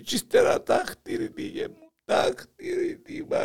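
mock whimpering. Grandpa breaks off, crying.

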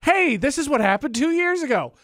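lifted, announcing retold reminder; phone reminder. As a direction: chirp it like an app alert. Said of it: "It was like".

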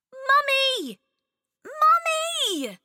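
put-on high, loud little girl's call; child calling out. Chloe calling her mum from bed, high and loud. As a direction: call for mum from across the house. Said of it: "she shouted".

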